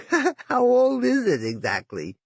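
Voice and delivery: high, giggling and slow; giggly. A narrator's reading. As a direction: giggle through the question. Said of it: "He giggled".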